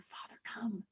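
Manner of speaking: soft whisper before dawn; gentle whisper. Acted. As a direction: wake him without a sound. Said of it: "he gently woke up his father".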